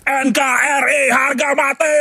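high, slow rally-style yell; patriotic shout. Pretend. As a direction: shout it like a protest chant. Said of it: "Terus aku teriak".

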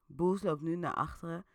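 ordinary delivery

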